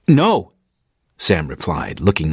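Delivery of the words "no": firm refusal. Acted. Sam firmly refuses, eye contact.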